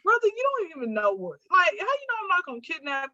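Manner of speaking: high-pitched retold disbelief; incredulous objection. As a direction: protest as if talking straight to him. Retelling her shocked objection to him.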